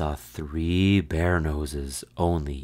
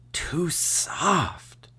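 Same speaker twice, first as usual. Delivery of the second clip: breathy high whisper; 11 semitones higher, 3 dB quieter than usual. A narrator's reading. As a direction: whisper it, sinking in. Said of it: "she said".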